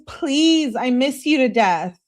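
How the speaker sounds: high, loud acted plea; pleading. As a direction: beg like a lovesick girlfriend. Acts out lovesick plea, later repeated plainly.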